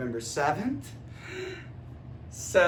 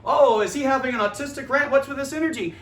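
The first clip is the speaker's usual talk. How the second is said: mocking skeptic; 6 semitones higher, 6 dB louder, faster than usual. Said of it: "mocking critics".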